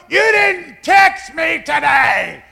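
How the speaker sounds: high, loud, slow accusing shout; mock angry wife. Wife scolding husband for not texting, loud high shout. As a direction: yell it like an upset wife. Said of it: "you don't even know what".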